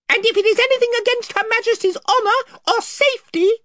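high, proud little mouse knight; Reepicheep. Acted.

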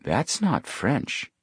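high, very slow, hushed realisation; surprised thought. A narrator's reading. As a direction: think it aloud in slow surprise.

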